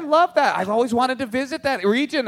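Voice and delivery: mock-enthusiastic passenger chatting up the driver; overeager passenger. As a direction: play the polite rider fishing for small talk. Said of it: "You're like".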